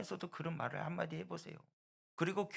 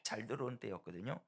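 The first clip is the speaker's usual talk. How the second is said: ordinary talk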